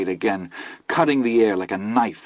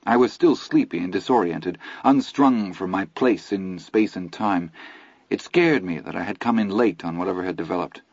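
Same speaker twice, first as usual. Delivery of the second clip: plain reading voice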